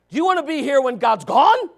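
ordinary talk, nothing marked

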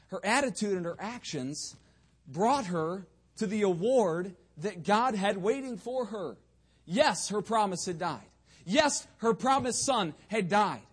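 building pulpit proclamation; emphatic preaching. Real, not acted. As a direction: drive the point home to the congregation.

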